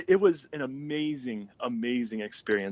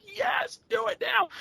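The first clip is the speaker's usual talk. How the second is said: very high, squealed yes; giddy eagerness; slower than usual. Retold or imitated. Retold giddy reply to boss.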